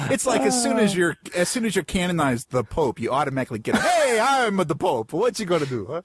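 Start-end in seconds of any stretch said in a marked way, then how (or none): 3.8-6.0 put-on Italian accent. Newly canonized Pope announcing himself, hammed-up Italian accent. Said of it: "I love how you give the Pope an Italian accent".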